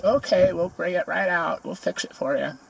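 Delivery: put-on cheerful counter girl, high and bright; imitating counter girl. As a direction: mimic the cheerful server. Counter girl voiced in story.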